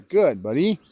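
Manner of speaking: ordinary talk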